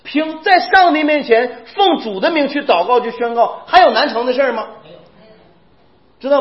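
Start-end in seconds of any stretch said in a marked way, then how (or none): none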